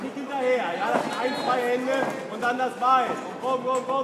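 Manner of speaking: loud urging. Real, not acted. Coach urging fighter on.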